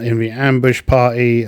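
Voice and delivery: ordinary talk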